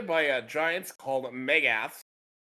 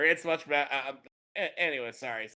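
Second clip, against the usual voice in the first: ordinary talk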